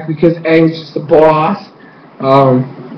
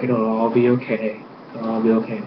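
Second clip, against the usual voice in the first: soft, low soothing voice; murmured reassurance, imitating a comforter; Tenzin; 6 semitones lower, 12 dB quieter than usual.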